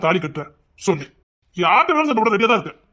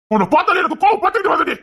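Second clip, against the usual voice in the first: angry outburst; 8 semitones higher than usual. Acted. Husband says anger flares.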